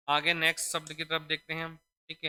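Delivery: normal talking voice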